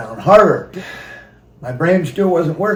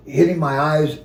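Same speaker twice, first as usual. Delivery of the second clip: normal talking voice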